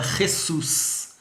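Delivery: Jesus said the Spanish way, with an h sound for the J: hesus; Jesus as in Spanish, h-sus; Spanish pronunciation of Jesus. Shown as a demonstration. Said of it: "em espanhol a gente fala".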